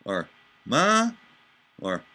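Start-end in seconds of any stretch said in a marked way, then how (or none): 0.7-1.1 "Ma?" with a steep upward pitch; rising tone. Shown as a demonstration. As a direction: sing "Ma?" rising high like a Mandarin tone. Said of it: "I had an inflection up".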